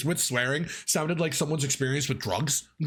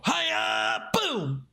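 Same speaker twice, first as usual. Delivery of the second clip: much higher karate kiai shout; 14 semitones higher than usual. Shown as a demonstration. Instructor shows the board-breaking kiai in a higher shout. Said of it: "you gotta yell".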